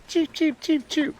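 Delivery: high, soft chirping cheeps; imitated quail cheep. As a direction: mimic a little hen quail's peep. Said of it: "she did that crazy little high pitch".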